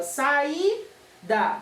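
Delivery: "saída" split, with a gap before the da; syllable by syllable. Shown as a demonstration. Teacher showing pupils the syllables, "saída" drawn apart. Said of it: "Na primeira sílaba".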